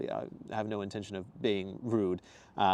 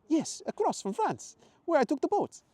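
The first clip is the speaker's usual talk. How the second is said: emphatic Italian traveller, pitched way up; Italian accent; 5 dB louder than usual. Acted. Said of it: "his faded Italian accent".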